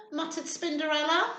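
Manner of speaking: usual speaking voice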